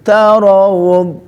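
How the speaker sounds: slow tarawud with heavy dark d; benar tarawud. Shown as a demonstration. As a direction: tarawud drawn out, d firm. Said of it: "Bukan meringis". (tarawud pelan, dal tebal)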